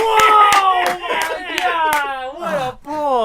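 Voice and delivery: high, loud astonished cry; loud, high mock awe; amazed. Real, not acted.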